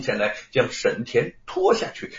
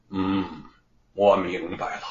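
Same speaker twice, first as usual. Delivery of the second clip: low, drawn-out knowing hum; half-amused; 8 semitones lower than usual. A narrator's reading. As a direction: let the realization out low, with a hint of mockery. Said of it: "似笑非笑地说". (似笑非笑地低声说)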